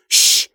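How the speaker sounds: forceful hushing hiss; urgent "shh"; sharp shush. A narrator's reading. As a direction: silence them with a quick shush. Hushes him in hiding.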